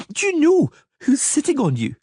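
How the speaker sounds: put-on a child pulling rank; indignant little girl. Princess on the Witcher's back demanding respect, high and indignant. Ciri threatens from his back.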